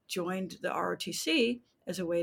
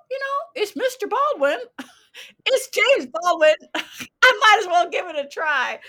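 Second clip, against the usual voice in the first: laughing self-talk; 10 semitones higher, 12 dB louder than usual. Retold or imitated. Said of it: "I thought".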